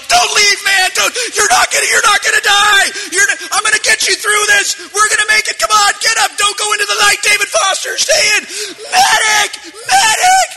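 high, loud frantic pleading shouts; desperate yelling. Retold or imitated. Player begging dying soldier, frantic high shouts. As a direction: scream at him to stay alive. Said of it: "I'm like".

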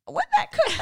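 squeaky laughing through the words; can't get the words out for laughing; laughing mock disbelief. Retelling the fish movie to her cohost, laughing. Mocking a silly movie detail.

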